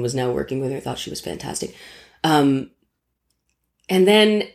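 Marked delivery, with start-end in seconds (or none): 0.8-1.7 hushed unvoiced whisper. Real, not acted. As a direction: whisper it like a secret confession. Confiding aside about Goldie.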